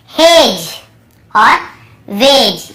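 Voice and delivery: हेज and वेज said as hej and wej, D silent; हेज and वेज spoken for students, no D; clear demo. Shown as a demonstration. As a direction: model हेज and वेज, each ending on the J. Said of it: "यहाँ पर भी D को हम नहीं पढ़ रहे हैं".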